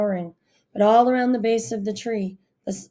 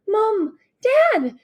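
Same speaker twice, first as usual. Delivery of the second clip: high, loud call to her parents; shouting; 13 semitones higher, 5 dB louder than usual. A narrator's reading. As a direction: call out across the park. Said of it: "she shouted".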